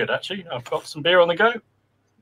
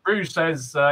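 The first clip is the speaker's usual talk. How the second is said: usual speaking voice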